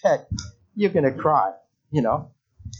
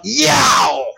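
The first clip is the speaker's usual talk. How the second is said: sharp loud yelp, pitched higher; a pained yelp from the shot in the foot; retold yelp of pain; 5 semitones higher, 5 dB louder than usual. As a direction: yell it out like a needle just hit your foot. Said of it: "I yelled out".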